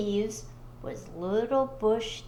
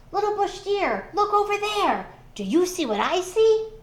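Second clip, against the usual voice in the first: eager trickster; 8 semitones higher, 4 dB louder than usual. Acted. Anansi springs his trick.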